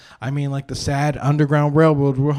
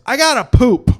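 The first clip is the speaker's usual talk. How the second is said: high, loud imitation of a toddler; little kid announcing; 9 semitones higher, 8 dB louder than usual. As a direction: announce it like an urgent three-year-old. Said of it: "this kid sits up ... and goes".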